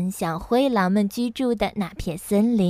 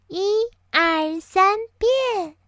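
拉长音调，越念越高; fairy's magic count, climbing in pitch to the last word; 仙女念咒; 10 semitones higher, slower than usual. A narrator's reading. 仙女施魔法喊口令. (仙女施魔法，音调逐级升高)

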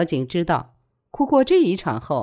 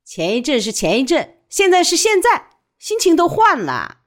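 high, loud dismissal; curt rebuttal; 11 semitones higher, 6 dB louder than usual. A narrator's reading. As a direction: shut the argument down, voice raised. Widow snaps back at pleading friend.

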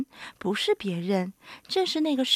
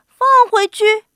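high, loud echo question; startled, incredulous outburst; astonished question; 9 semitones higher, 11 dB louder than usual. A narrator's reading. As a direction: blurt it back in disbelief. Animals astonished at woman's refusal.

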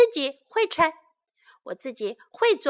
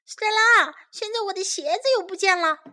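high, quick complaint to sister; child calling out; 6 semitones higher, 4 dB louder, faster than usual. A narrator's reading. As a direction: call out loud and high, then rush on. Child calls sister over lost shoes.

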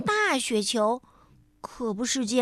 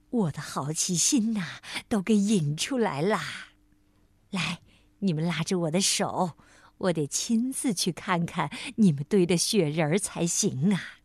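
put-on kindly old bear granny; 7 semitones lower, 3 dB quieter than usual. A narrator's reading. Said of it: "熊婆婆说".

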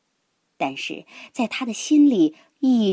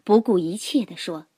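usual speaking voice

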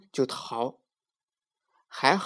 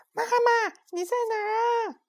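monkey echo. A narrator's reading. Monkey echoing the boy.

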